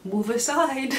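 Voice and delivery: mock bossy character. Seasonal depression tells regular depression to move, mock bossy. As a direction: shove the old gloom out of the way.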